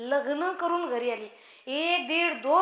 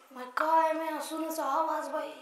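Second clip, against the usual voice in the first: slow, softened voice of a mother-in-law telling the neighbours; acted gossipy aside, slow and soft; gossiping mother-in-law; 6 dB quieter than usual. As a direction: draw it out in mock wonder. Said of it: "शेजारींना सांगते".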